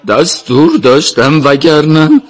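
high, loud, slow protest; anxious excuse. A narrator's reading. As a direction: plead your excuse as one cornered. Cornered governor excuses himself.

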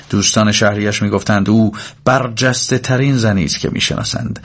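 usual speaking voice

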